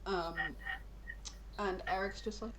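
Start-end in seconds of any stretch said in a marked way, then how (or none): none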